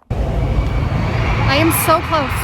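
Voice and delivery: slow words pushed out through laughter; laughing. Real, not acted. Stranded near the venue, laughing helplessly at being so close. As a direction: laugh it out, nearly there.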